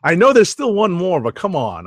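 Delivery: usual speaking voice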